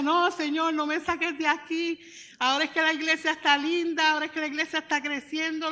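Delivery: pleading protest. Retold or imitated. Retold protest to God.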